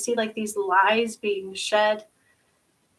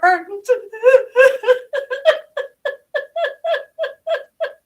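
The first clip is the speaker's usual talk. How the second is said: high, loud wail caught between laughing and crying; real laughing sob; anguished laugh-sob; 13 semitones higher, 8 dB louder than usual. Real, not acted. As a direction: sob out a half laugh of pain. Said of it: "I feel like I'm burning".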